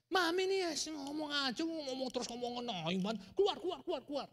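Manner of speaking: put-on high, loud whiny child telling mum to get out; bratty daughter. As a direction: shoo mum away like a cranky little girl. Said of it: "[name] berkata". (anak merengek keras mengusir maminya)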